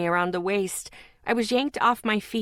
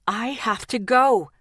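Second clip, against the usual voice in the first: a louder, pleading yell; 4 dB louder than usual. Acted. Lexi yells at Zeke to let her go, loud. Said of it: "I yelled".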